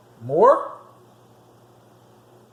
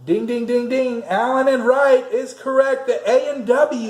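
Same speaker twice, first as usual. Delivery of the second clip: game show host; 7 semitones higher than usual. Acted. Quiz host announcing right answer.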